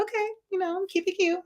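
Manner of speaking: high, soft and sassy; soft sassy keep-it-cute; sassy okay. Retold or imitated. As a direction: brush it off with a sweet, sarcastic lilt. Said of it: "So I was like".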